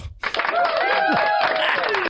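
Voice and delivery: big high burst of laughter; real, high-pitched cackling laugh; loud laugh. Real, not acted. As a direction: crack up loudly at yourself. Laughing at his own rant.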